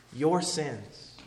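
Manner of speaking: normal talking voice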